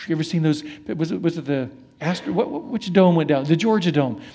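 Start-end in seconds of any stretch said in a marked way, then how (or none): none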